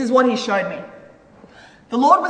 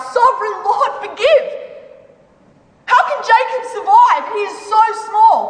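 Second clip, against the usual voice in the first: high-pitched pleading cry with a laugh in it; 9 semitones higher than usual. A narrator's reading. Prophet crying out to the Lord for Jacob, high pleading. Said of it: "I cried out".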